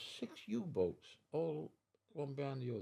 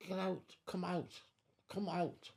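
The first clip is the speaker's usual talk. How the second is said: high-pitched calling out, no real volume; imitated shout; 8 semitones higher than usual. Crewmate calling him out of his cabin, imitated shout. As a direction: call it out high, mimicking a crewmate. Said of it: "I heard one of the lads shout".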